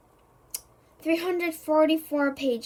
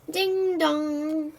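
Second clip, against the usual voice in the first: doorbell imitation; 3 semitones higher than usual.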